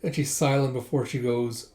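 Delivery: ordinary delivery, nothing marked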